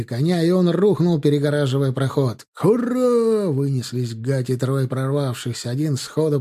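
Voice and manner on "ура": high charging hurrah; battle cry. A narrator's reading. Three riders bursting through, battle cry. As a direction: let out a ringing hurrah.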